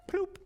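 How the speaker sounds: high popping ploop; ploop sound. Shown as a demonstration. Mom showing kids the sound of a drop, high ploop. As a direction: make a silly dropping noise. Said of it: "it would make the sound".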